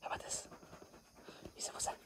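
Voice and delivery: soft whispered question. Real, not acted. Speaker whispering to the kitten, playful.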